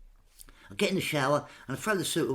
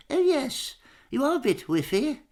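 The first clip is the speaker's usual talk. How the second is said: much higher, louder prim royal verdict; the Queen after sniffing him; posh Queen voice; 12 semitones higher, 6 dB louder than usual. Retold or imitated. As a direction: deliver it like a polite monarch. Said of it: "she went".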